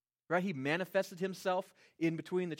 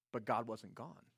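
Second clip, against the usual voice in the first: ordinary delivery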